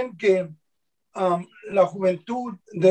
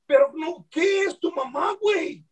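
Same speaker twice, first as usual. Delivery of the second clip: pitched much higher, fast in places; replaying both sides of the quarrel; retold back-and-forth; 8 semitones higher than usual. The other guy backpedals and he presses, high retold quarrel.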